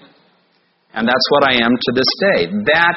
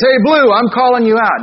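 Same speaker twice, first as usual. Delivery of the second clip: a high, louder taunt from a big bully; bully calling out; 9 semitones higher, 4 dB louder than usual. Retold or imitated. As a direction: call him out like a schoolyard bully. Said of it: "he says".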